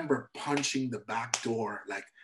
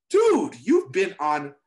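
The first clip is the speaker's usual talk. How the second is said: incredulous; 2 semitones higher, 6 dB louder, much slower than usual. Retold or imitated. Inner disbelief at nervous star.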